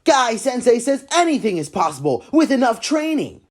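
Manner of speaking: low earnest training voice; deep, proud martial artist; Rock Lee. Acted. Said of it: "I, Rock Lee".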